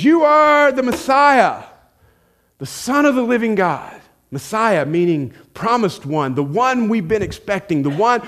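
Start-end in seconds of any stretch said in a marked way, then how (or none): 0.0-4.0 blurted out. Retold or imitated. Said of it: "he just blurts this out".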